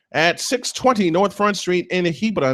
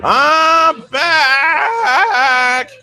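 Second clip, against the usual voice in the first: voice crack; 11 semitones higher, 6 dB louder than usual. Real, not acted. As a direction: voice cracking up high on a loud shout. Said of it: "Sorry, voice crack".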